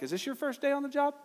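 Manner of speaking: high, mock-innocent question; stinging sarcasm. Needling dad over a wrong measurement, sarcastic. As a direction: needle a veteran as if he were new. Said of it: "that kind of punch, that kind of sting".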